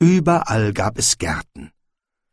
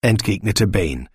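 ordinary delivery

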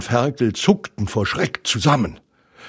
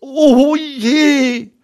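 high, loud, drawn-out wail; acted high-pitched despair; despairing cry; 6 dB louder than usual. A narrator's reading. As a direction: cry out in piglet dismay. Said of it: "rief er verzweifelt".

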